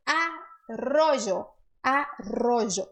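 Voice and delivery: slow arrojo with a trilled rr and a j sound; rolled rr in arrojo. Shown as a demonstration. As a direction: draw out arrojo, rolling the rr. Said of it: "treinar o R vibrante".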